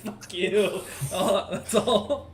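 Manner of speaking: high, loud laugh into the words; cracking up, pitched high. Real, not acted. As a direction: talk through real laughter. Player laughs at being hurled.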